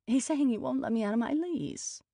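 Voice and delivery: high, loud whine; whiny tenant's grievance. A narrator's reading. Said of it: "she said in a whiny voice".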